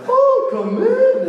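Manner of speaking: very high, slow German granny falsetto; drawn-out, hammed-up German old-lady voice; German grandma. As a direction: coo it like a wolf faking a German grandma. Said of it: "he's trying the German accent out".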